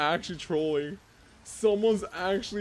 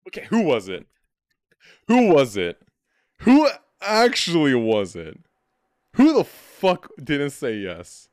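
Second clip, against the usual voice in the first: angry demanding; 3 semitones lower, 8 dB louder than usual. Real, not acted. Angry at teammate who refused.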